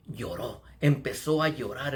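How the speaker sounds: ordinary delivery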